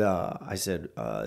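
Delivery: ordinary talk, nothing marked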